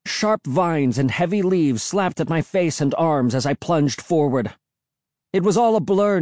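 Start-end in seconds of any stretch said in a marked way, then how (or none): none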